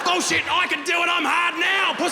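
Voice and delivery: high, loud jeering yell; mock heckler shout. Comic mocking a heckler who yelled out, jeering shout. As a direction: shout it like a cocky drunk heckler.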